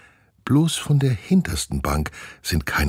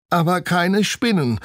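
put-on worried girl; 14 semitones higher, 3 dB louder than usual. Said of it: "sagt Steffi".

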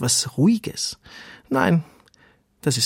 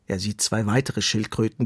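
ordinary delivery

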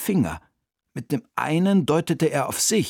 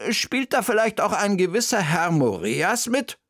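raised, probing tone; shrewd question; 7 semitones higher than usual. A narrator's reading. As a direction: ask it knowingly, fishing for confirmation. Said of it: "scharfsinnig, wie sie nun mal war". (scharfsinnig nachgefragt, mit gehobener Stimme)

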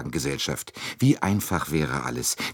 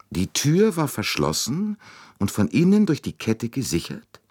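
pondering question, raised in pitch; musing, higher-pitched query; thoughtful; 7 semitones higher than usual. A narrator's reading. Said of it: "wiederholte Poirot nachdenklich".

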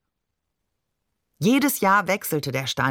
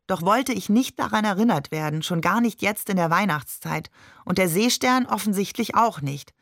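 ordinary delivery

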